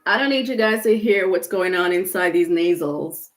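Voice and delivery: blocked nose; stuffy nose. Real, not acted. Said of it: "I'm a little stuffy".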